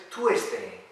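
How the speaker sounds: "Tuesday" as too-ES-day; mispronunciation demo. Retold or imitated. Teacher repeating what students often say, mispronounced. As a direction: say "Tuesday" with a T and the E sounded. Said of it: "Es que me dicen muchas veces".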